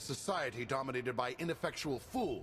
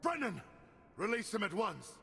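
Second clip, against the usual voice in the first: high, desperate shout for his son; frantic cry; 11 semitones higher than usual. Acted. Father shouts as his son is taken hostage. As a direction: cry out for your captured son.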